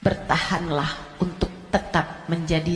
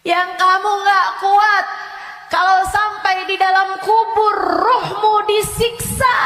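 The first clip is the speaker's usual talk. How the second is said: loud, high, shouted sermon; fiery preaching; 10 semitones higher, 6 dB louder than usual. Acted. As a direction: warn the crowd at full force. Ustazah preaching about the grave.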